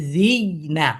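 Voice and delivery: জি closed on a y, then না; জি না with a pressed y between; sakin on ya. Shown as a demonstration. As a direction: catch the end of জি on a y before না. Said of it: "যদি থাকে".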